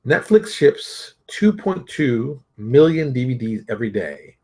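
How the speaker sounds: measured, deliberate article read; reading aloud. Acted. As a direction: read it out like a quoted passage.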